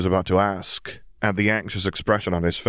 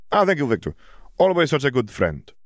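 happy; 8 semitones higher than usual. Acted. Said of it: "Polyakov said happily".

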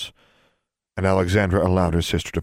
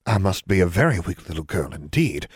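ordinary delivery, nothing marked

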